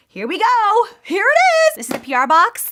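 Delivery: high, loud squealing reveal; thrilled shout; excited reveal. Real, not acted. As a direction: announce it like a big unveiling. Revealing box on camera.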